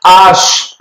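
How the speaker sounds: "आश" with no h sound at the start; H said "आश", silent h; French letter name. Shown as a demonstration. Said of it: "इसकी sound एच नहीं आती". (French H "आश", बिना ह के)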